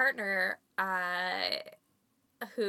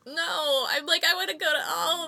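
high whining protest; whiny; 8 semitones higher, 5 dB louder than usual. Retold or imitated. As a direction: whine like a greedy kid. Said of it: "And I was like".

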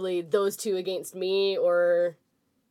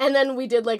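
usual speaking voice

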